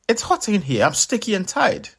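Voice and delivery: high annoyed Anansi voice; whiny spider. Acted. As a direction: whine about the heat. Anansi annoyed inside melon.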